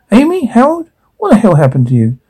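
high startled shout, then fast; shocked high call. Acted. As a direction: burst out in alarm, then rush. Shocked call to injured son.

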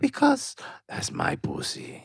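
high, slow whisper; mock mom whisper. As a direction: hiss it as the mom. Mom voiced in the bit.

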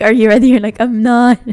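high, loud playful re-enactment; mock excited exchange. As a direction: act it out loud and high, amused. Jokingly acts out guests and groom.

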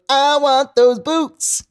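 high, slow whiny wanting; SpongeBob voice. Retold or imitated. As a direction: whine it like a cartoon kid. Quoting SpongeBob.

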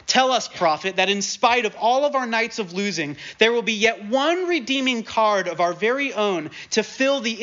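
heightened oratory voicing a desperate plea; dramatic reading. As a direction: plead it from the pulpit with fire. Reads quoted plea dramatically.

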